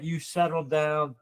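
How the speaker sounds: ordinary delivery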